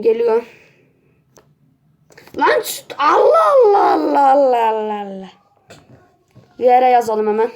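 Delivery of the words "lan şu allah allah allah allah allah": frustrated shout. Real, not acted. Gamer reacting to his in-game death, anguished wailing shout.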